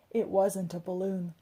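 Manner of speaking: usual speaking voice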